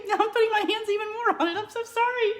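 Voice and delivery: high laughing apology. Real, not acted. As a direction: laugh through the words. Laughing fit over licked bite.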